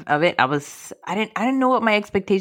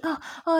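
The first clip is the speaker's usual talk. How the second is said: mock moan; 12 semitones higher, 5 dB quieter than usual. Movie first time mocked.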